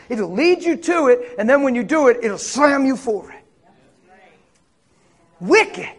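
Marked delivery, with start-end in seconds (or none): none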